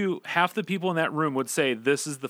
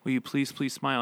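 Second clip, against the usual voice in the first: soft; 5 dB quieter, faster than usual. Retold or imitated. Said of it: "and very softly".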